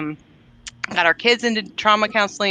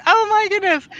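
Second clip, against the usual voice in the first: much higher, exasperated exclamation; a mock exasperated parent, pitched way up; 12 semitones higher than usual. Mom reacting to yelling teenagers, hammed-up exasperation. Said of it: "I'm like".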